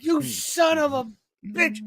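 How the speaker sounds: high, slow mock-indignant curse; retold scolding grandmother. Grandmother to grandson after he went too far, slow acted reproach. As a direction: draw the curse out as an aggrieved granny.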